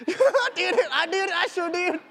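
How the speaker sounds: high, laughing admission; laughing confession. Real, not acted. Runner confessing his water trick to the audience, laughing.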